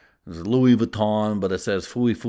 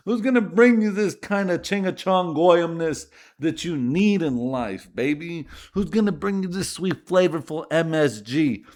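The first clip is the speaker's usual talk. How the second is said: hype announcer; 5 semitones higher than usual. Pretend. Podcast host hypes himself.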